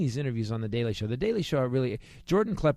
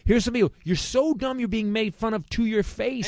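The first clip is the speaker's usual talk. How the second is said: high-pitched, incredulous rant; exasperated outburst; 10 semitones higher, 4 dB louder than usual. Real, not acted. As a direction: voice shoots way up in exasperation. Exasperated rant at mocked interviewees.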